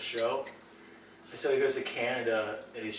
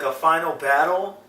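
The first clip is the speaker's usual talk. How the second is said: ordinary talk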